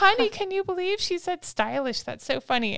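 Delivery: high, delighted exclamation to her husband; amused delight. Retold or imitated. Mother delighting to husband about the toddler's word, gleeful. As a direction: tell him with laughing glee. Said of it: "I was like".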